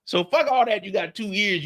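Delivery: high, stretched-out comic impression; Chris Tucker. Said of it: "Ty understood Chris Tucker".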